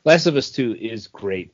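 ordinary talk, nothing marked

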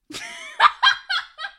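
high, loud burst of laughter; loud shrieking laugh. Real, not acted.

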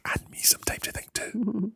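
hushed whispered aside; a secret whispered aside. Real, not acted. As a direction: whisper it conspiratorially to the listeners.

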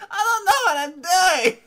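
put-on very high, loud wail in a botched Italian accent; hammed-up shriek in a lost Italian accent; wandering fake accent. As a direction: yell in helpless confusion, Italian accent falling apart. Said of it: "What is this accent now?".

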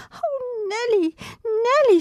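high, anxious helpless calling. Acted. Sister helplessly calling to the injured child. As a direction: plead uselessly for help. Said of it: "said poor Kate helplessly".

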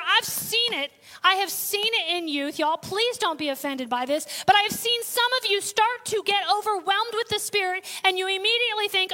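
normal talking voice

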